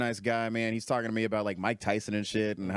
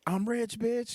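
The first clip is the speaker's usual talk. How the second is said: high-pitched imitation of a drunk heckler; mock audience holler, pitched way up; catchphrase yell; 13 semitones higher, slower than usual. Drunk fans grab the mic at Q and A, hollering.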